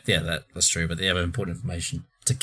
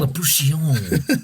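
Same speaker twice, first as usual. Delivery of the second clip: slow, higher mock French Leproutian; French accent; 7 semitones higher, 4 dB louder, much slower than usual. Joking about a French title for Leprechaun, mock French. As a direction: stretch Leproutian out like a French word. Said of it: "Imagine it's called like in France".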